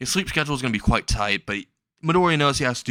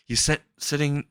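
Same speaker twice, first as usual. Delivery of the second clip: plain reading voice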